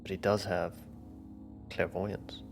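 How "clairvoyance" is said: normal talking voice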